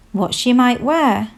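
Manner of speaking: plain reading voice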